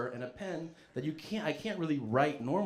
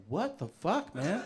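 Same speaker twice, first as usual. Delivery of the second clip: high-pitched comic disbelief; retold shocked dismay; 6 semitones higher, slower than usual. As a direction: act appalled, pitch jumping up. Retold shock at cleaner chemicals.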